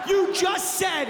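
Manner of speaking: a dad shouting you just said at his son; indignant shout. Retold or imitated. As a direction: yell back at the son in disbelief. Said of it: "why are we talking louder".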